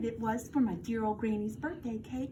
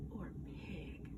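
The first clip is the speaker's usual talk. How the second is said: playful unvoiced whisper; 8 semitones lower, 17 dB quieter than usual. Shown as a demonstration. Teacher teasing the class with the choice, whispered. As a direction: tease the second choice in a whisper.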